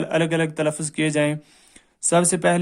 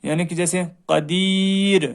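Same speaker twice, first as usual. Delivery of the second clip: ordinary delivery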